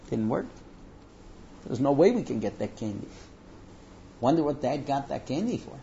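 low, soft letdown; acted dejection. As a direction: give up quietly, mildly deflated. Boy reports failure to sister.

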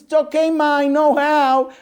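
put-on very high, loud child voice; eager little kid. Child offering to get own drink, loudly eager. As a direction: call out to mother like a confident small child. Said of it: "And the kid says".

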